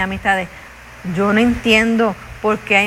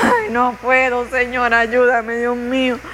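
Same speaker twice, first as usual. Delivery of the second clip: weary plea. Retold or imitated. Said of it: "Vuelvo para atrás".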